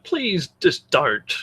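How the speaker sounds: ordinary delivery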